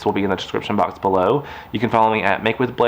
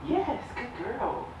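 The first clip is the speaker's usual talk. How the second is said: much higher, softer, slow praise; 13 semitones higher, 11 dB quieter, much slower than usual. Real, not acted. Owner cooing softly at her puppy, high and hushed. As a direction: coo gently at the puppy.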